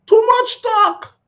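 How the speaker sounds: high, slow mocking squeak. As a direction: squeak it out slowly in mockery. Mocking the rambling talkers.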